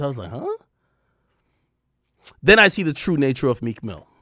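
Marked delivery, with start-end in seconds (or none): 0.3-0.6 retold puzzled little question; confused. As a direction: grunt a baffled question. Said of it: "I was confused".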